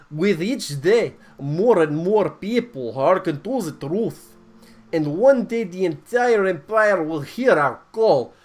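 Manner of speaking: put-on booming Russian accent sermon. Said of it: "I'm gonna do my Russian accent".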